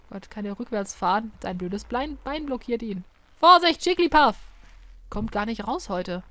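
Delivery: ordinary delivery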